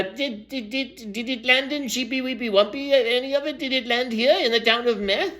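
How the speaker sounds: high, stammering little animal voice; young kangaroo. Acted. Young kangaroo asks the storyteller about the town, high little voice. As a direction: ask in a small high voice, stumbling at the start. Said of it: "I think it was a young kangaroo".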